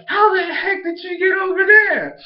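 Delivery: imitating total bewilderment; astonished. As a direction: squeal it like a baffled cartoon crowd. Acted group astonishment at Bonnie.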